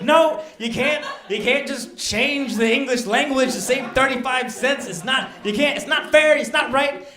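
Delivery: loud, high indignant pleading; indignant protest. Retold or imitated. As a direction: protest like it's a grave injustice. Retold protest to corporate.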